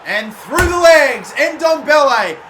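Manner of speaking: loud, drawn-out angry yell at the screen; frustrated shout. Real, not acted. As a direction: bellow it, slow and furious. Goal conceded through the legs.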